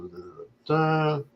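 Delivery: ordinary delivery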